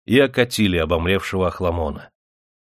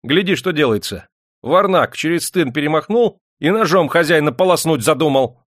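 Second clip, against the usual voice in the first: loud, high, outraged scolding; angry; 6 semitones higher, 5 dB louder than usual. A narrator's reading. Master shows bodyguard the intruder who leapt the fence, angry outrage. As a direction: cry out in indignation. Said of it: "осердился Демидов".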